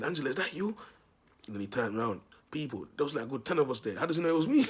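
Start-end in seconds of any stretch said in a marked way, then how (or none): 0.0-0.8 teasing call. Retold or imitated. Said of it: "One day I said". 4.0-4.6 loud, high-pitched disbelief; how on earth did he know. Real, not acted. Telling viewers how he got caught, loud, high and disbelieving.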